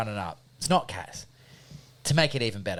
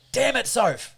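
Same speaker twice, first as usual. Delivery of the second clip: higher, drawn-out annoyed complaint; mock exasperated dad; 10 semitones higher, slower than usual. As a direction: blame an absent partner in comic frustration. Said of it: "and then I'm like".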